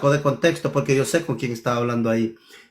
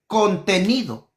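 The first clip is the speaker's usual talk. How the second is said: Contenido slow and higher, set apart as a single word; stress on Contenido; 5 semitones higher, slower than usual. Shown as a demonstration. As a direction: land hard on Contenido, slowly. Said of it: "me refiero al contenido". (Contenido, lento y enfatizado)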